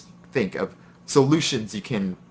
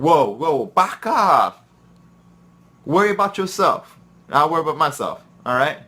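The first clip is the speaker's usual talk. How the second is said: defensive snap; 5 semitones higher, 4 dB louder than usual. Retold or imitated. Quoted pastry coworker snapping back.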